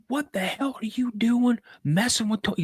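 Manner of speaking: high-pitched angry confrontation; pissed-off dad. Retold or imitated. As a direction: imitate a furious dad demanding answers.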